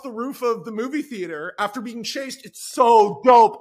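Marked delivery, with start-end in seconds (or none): 2.4-3.6 slow, much louder gush; too excited. Real, not acted. Podcaster gushing about his own werewolf scene, excited. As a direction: let it burst out overexcited. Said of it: "I said that in a little too excited of a voice".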